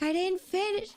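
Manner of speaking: squeaky high frustrated protest. Retold or imitated. Caller protesting to the phone bot, high and exasperated. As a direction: pitch it up in exasperation. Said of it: "I'm like".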